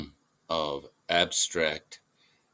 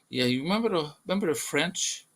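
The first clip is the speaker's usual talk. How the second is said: high-pitched alien setting up a joke; 9 semitones higher, much faster than usual. Acted. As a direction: ask it up high, playing the alien. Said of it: "they're like".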